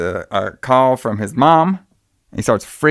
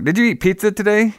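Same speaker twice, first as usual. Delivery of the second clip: a mother's question, pitched well up; lightly voiced mom, high; playful imitation of the mom, high; mom's voice; 9 semitones higher than usual.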